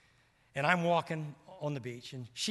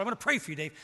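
loud and higher, eager; Steve's earnest offer; 6 semitones higher, 6 dB louder, much faster than usual. Retold or imitated. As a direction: press the offer warmly.

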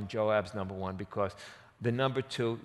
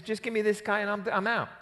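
quick, higher offhand line; breezy deal; 9 semitones higher, faster than usual. Retold or imitated. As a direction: rattle it off casually. Joab's quick paraphrase.

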